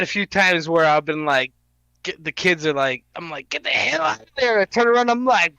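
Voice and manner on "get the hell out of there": retold panic. Retelling panic during a game.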